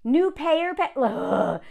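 ordinary talk

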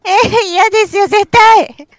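high, loud whiny refusal; loud pleading no; loud protest. Real, not acted. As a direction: wail it out, no way. Refusing to play the game.

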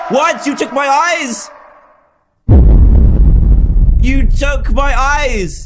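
high, loud horrified cry; shocked yelling. Real, not acted. Gamer crying out in shock at the ending. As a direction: cry out in disbelief.

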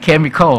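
chemical with its final L fully voiced; final L sounded. Shown as a demonstration. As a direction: finish chemical on a clear dark L. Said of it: "เมืองนอกมัน L มันต้องออกนะ".

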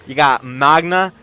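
loud, thrilled fan shouting; hyped shouting. Real, not acted. Hyped reacting to anime fight.

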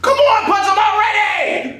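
high, stretched-out yell; impatient urging. Acted. Cellmate urging him to punch the guard, impatient. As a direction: yell it over, dragging the words.

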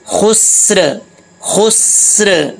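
"खुस्र" slowly, holding the hissed स straight into र; correct recitation demo. Shown as a demonstration. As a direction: recite "खुस्र" drawn out with no vowel after स. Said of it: "जज्म है". ("खुस्र" में सीन पर जज़्म, धीरे खींचकर)

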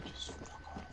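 hushed whispered curse; whispering while hiding. Real, not acted. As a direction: whisper it so nobody hears. Hiding from enemy in building.

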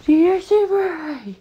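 high thrilled shout of the name; excited high shout. Real, not acted. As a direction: cheer it out high. Excited about getting a card.

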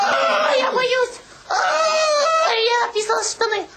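hammed-up frightened shrieks; mock panic. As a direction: squeal like you're scared stiff. Playful panic on the board. (шуточная паника)

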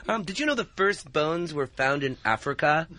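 usual speaking voice

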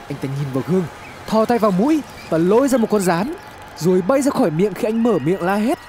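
plain reading voice

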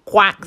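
loud duck quack; a bright duck quack. A narrator's reading. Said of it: "said the duck".

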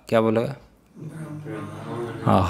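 normal talking voice

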